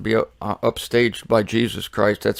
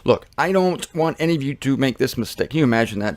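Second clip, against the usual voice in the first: usual speaking voice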